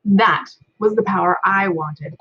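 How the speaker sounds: usual speaking voice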